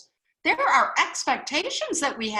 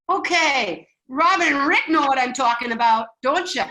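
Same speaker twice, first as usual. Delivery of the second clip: loud, hammed-up Wisconsin accent; 2 semitones higher, 7 dB louder than usual. Teasing Robin and Rick who know the type, Wisconsin accent. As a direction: keep up the big Wisconsin accent. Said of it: "my best Wisconsin accent".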